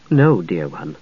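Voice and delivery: wife gently telling her husband no. A narrator's reading. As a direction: let him down tenderly. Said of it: "she said gently".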